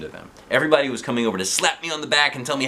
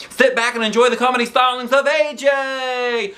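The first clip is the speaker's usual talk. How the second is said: high, loud showhost shout; announcer shout; 13 semitones higher, 4 dB louder than usual. A narrator's reading. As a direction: belt it out to the whole room. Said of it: "she announced".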